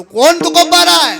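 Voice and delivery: high, loud, slow proclamation; stretched declamatory cry; ringing rhetorical question. Real, not acted. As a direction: throw the question out to the whole hall. Rhetorical refrain to congregation.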